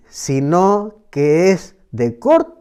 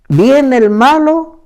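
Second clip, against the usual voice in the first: higher, louder and slow on "viene el malo"; dramatic emphasis; 7 semitones higher, 7 dB louder, slower than usual. Acted. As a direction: lean hard on "malo" as a warning. Preacher warns of the evil one.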